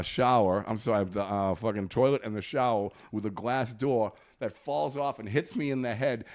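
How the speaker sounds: ordinary delivery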